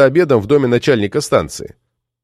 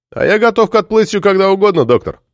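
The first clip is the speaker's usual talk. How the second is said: lightly voiced skipper, higher pitch; skipper's reply; 8 semitones higher than usual. A narrator's reading. Skipper tells the doctor he is ready, higher voice. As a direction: answer readily in a raised voice.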